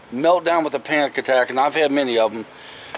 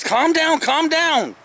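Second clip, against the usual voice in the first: much higher-pitched imitation of a nurse; urgent calming plea; 12 semitones higher, much slower than usual. Nurse to panicking patient, imitated high-pitched plea. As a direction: pitch it way up, urging calm. Nurse urging him to calm down.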